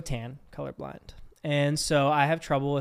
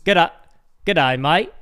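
loud, broad Aussie greeting; amateur Australian accent demo; 2 semitones higher, 7 dB louder than usual. Shown as a demonstration. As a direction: put on a hammed-up Australian accent. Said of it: "if I'm doing Australian".

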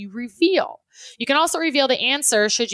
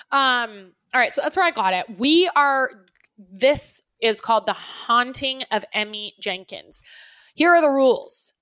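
normal talking voice

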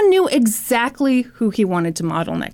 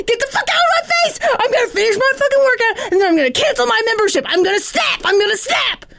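very high, frantic mock screeching; John's furious meltdown; 12 semitones higher than usual. Gym member freaking out at complainer, hysterically furious. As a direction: escalate into a fast, hammed-up tantrum. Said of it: "I feel another rant coming on".

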